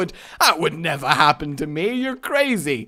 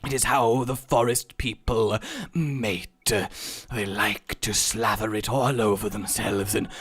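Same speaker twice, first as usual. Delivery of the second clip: nature documentary narration; 3 semitones lower, 4 dB quieter than usual. Pretend. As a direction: narrate like a whispering nature presenter.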